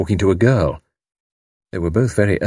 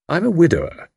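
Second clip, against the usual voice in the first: higher-pitched, mildly dignified declaration; 9 semitones higher than usual. A narrator's reading. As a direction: state it with a touch of dignity. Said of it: "said Tommy with dignity".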